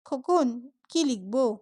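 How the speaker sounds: ordinary delivery, nothing marked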